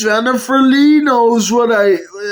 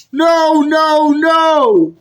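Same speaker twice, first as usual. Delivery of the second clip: protesting shout; 3 semitones higher, much slower than usual. Acted. Protesting the dead Big Bird.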